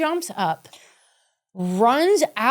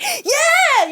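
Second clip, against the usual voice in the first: much higher, louder, laughing "Yeah"; relieved cheer; 5 dB louder than usual. Retold or imitated. Host cheering that nobody is hurt, a much higher, louder laughing "Yeah". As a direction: cheer like you just survived. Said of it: "I was like".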